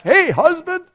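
high, loud answering holler; imitated yell back. Wife answering her husband in the jail chapel, imitated yell. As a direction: yell it back across the divider. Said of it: "she yells back".

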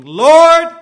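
a drowning man's loud cry. Acted. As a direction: cry out for rescue with everything. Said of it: "he cried".

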